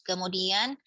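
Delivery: ordinary delivery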